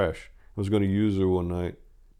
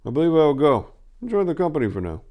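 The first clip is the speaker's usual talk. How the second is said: lightly voiced Turga; 7 semitones higher, 4 dB louder, faster than usual. A narrator's reading. Turga leaves the party.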